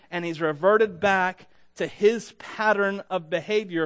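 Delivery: usual speaking voice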